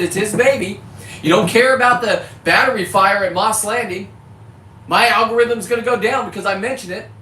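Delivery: really lit up and going off about it; fired-up rant. Real, not acted. Ranting at viewers about Gavin Newsom, all fired up. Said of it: "I'm not gonna be this lit".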